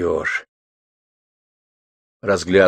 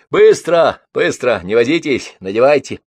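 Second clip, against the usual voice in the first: loud, raised opening, easing slightly; hurrying orders; 5 semitones higher, 5 dB louder than usual. A narrator's reading. Bibichev hands out aprons to the girls, hurrying them.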